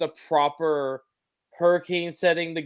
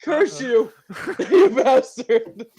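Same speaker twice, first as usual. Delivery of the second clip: slow curse broken by laughter; laughing mock curse; 7 semitones higher, 4 dB louder, slower than usual. Real, not acted. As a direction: curse him while cracking up. Cursing a friend while laughing.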